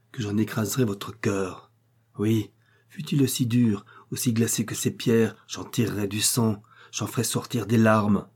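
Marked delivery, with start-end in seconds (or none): none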